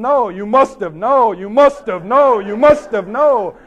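loud, drawn-out mock squabble; stubborn argument. Poet and professor contradict each other, loud and stubborn. Acted back-and-forth quarrel escalates.

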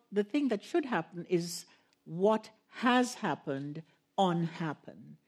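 ordinary talk

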